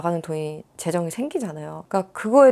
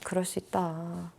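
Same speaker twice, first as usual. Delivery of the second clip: gentle comfort; 9 dB quieter than usual. Retold or imitated. Comforting a reader while patting.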